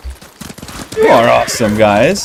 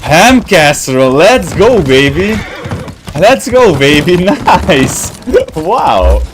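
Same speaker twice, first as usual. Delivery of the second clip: louder, higher hype shout; excited cheer; 5 semitones higher, 4 dB louder, slower than usual. Real, not acted. Streamer hyping a viewer's favorite food, excited cheer. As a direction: cheer it like a win.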